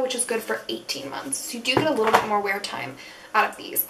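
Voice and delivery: normal talking voice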